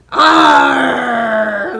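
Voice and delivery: loud, drawn-out pirate cry; pirate arr. Pretend. Reader shows children the pirates, loud pirate arr. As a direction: play at being a pirate, full volume.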